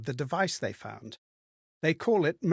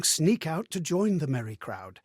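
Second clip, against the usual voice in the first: usual speaking voice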